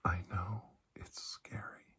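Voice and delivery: normal talking voice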